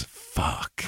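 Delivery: whispered curse, very soft. Retold or imitated. Teen whispers a curse as the plant falls. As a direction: breathe the word out under your breath. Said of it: "He just goes".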